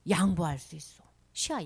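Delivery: ordinary talk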